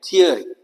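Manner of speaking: थियरी said clearly as THEE-uh-ree; teacher models the "ee-uh" glide in थियरी; ear-sound demo. Shown as a demonstration. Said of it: "यही आवाज आएगी". (थियरी में "इय" की आवाज़ साफ़ बोलकर)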